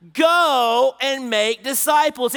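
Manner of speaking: loud, slow, high emphatic Go; loud emphatic command; loud slow proclamation. Shown as a demonstration. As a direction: proclaim it to the room.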